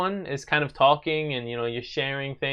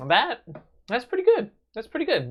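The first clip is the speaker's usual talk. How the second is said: pleasantly surprised; 10 semitones higher than usual. Real, not acted. First sip of new soda.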